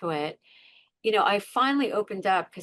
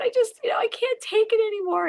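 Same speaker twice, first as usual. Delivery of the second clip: much higher, tearful and strained; crying; 13 semitones higher, 4 dB louder, faster than usual. Retold or imitated. Woman still crying to the police, at breaking point. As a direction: sob it out, barely holding on. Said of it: "And I'm crying".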